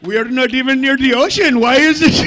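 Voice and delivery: loud mock-baffled protest; shouted bewilderment. Comic protesting they are nowhere near the ocean, loud disbelief. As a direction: holler the absurdity out.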